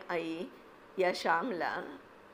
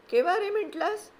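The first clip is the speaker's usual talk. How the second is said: high, loud mocking boy's question; teasing surprise; 13 semitones higher, 6 dB louder than usual. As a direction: jeer at him, pitched way up. Boy Vasu teasing Shyam.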